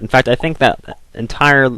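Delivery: normal talking voice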